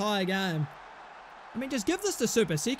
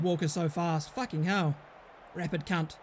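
ordinary talk, nothing marked

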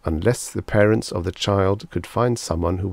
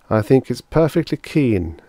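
tense, higher-pitched reply; taut; 6 semitones higher, 4 dB louder than usual. A narrator's reading. Father answers severe doctor on population control, tautly. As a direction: answer tightly, holding it in. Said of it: "said Whaling tautly".

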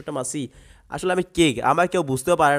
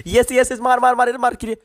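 high, louder "yes, kill"; loud cheering; 8 semitones higher, 5 dB louder than usual. Real, not acted. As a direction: cheer the shot on. Urging kill in fight. (উঁচু গলায় "yes, মার" বলে উল্লাস)

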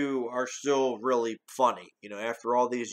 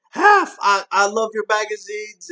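much higher and louder starstruck cry; gushing fan quote; 8 semitones higher, 8 dB louder than usual. Retold or imitated. Reviewer quoting the gushing line to Hef, high and loud.

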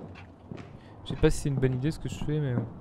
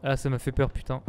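ordinary delivery

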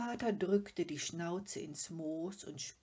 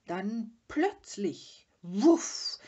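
slow build to a loud whoosh; dramatic sudden burst; 4 semitones higher, 12 dB louder, much slower than usual. A narrator's reading. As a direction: stretch the lead-in, then burst out. Father acts out fire flaring up. (gedehnt, dann explosionsartig laut)

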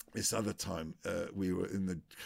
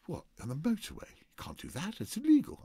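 rising higher into a squeaky it's illegal; mock horrified outrage; shocked agent; 10 semitones higher than usual. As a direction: sound aghast on the phone. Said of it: "He said".

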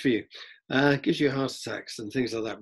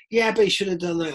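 higher, pushy fan retort; bloke in the crowd; 7 semitones higher, 4 dB louder than usual. Retold or imitated. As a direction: argue back like a mate. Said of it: "the bloke next to you is going".

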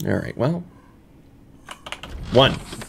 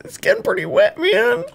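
jumps much higher into a squeak; a squeaky panicked cry about the water. Real, not acted. Water rising in game.

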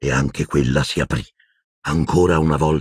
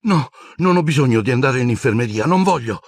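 higher, hurried protest; flustered babble; 9 semitones higher than usual. A narrator's reading. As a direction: splutter it out, refusing. (un ragazzo che farfuglia agitato)

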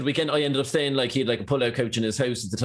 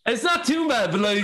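higher Essex geezer accent; 10 semitones higher than usual. Shown as a demonstration. Asked on the spot to do an Essex accent, higher Essex geezer voice. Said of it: "a lot of the geezers speak like this".

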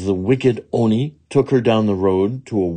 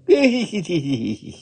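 high rippling giggle; irrepressible tittering; 10 semitones higher than usual. Acted. Little woman hiding behind Jizo, helpless high tittering. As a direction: let a high giggle escape. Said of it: "could not keep from laughing".

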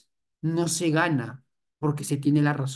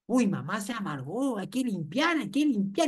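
mock hushed kids; 4 semitones higher, 3 dB quieter than usual. Kids scramble after mom sulks.

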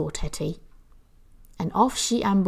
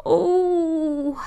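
whispered, crying moan; 8 semitones higher than usual. A narrator's reading. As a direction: breathe out a tearful oh. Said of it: "she moaned".

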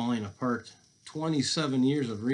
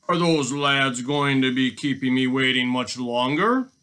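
lightly voiced robber, louder, rising at the end; a louder storybook villain grumbling impatiently; 3 semitones higher, 10 dB louder than usual. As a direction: ask it as a waiting bandit. Robber waiting in ambush, voiced.

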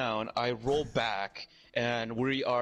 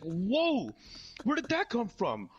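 higher-pitched mock alarm; startled; 10 semitones higher, slower than usual. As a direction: yelp it in disbelief, acting it out. Acts out his startled self.

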